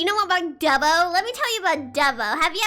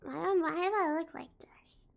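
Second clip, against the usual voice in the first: whisper; 8 dB quieter than usual. Real, not acted. Surprised at own camera image.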